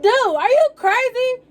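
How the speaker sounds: very high, loud, outraged disbelief; incredulous outburst. Retold or imitated. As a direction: throw it back at them in an indignant squeal. Retold angry retort to dad.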